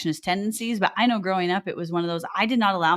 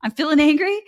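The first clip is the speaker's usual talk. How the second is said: mock angry outburst; 5 semitones higher, 6 dB louder than usual. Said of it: "And I was like".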